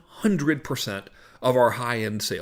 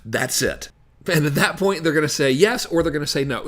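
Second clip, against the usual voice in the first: ordinary talk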